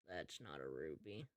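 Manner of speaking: low, quiet inner remark; private aside. Acted. Sheriff privately recognizes the singer, low and quiet. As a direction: drop down to a muttered thought.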